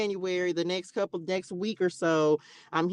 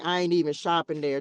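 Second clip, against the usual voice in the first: ordinary talk, nothing marked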